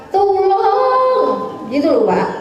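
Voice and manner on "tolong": mock goat cry. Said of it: "Kedua bilang".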